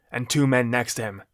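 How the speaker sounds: ordinary delivery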